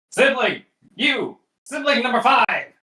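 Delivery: high-pitched call to a sibling; hammed-up high voice picking out a helper; mock summoning. Acts out summoning a sibling for help.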